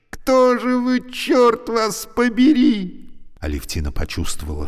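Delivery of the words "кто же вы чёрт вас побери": high, loud, slow exasperated outburst; losing patience. A narrator's reading. Trapped woman losing patience with the voice. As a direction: demand it, fed up. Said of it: "окончательно теряет терпение".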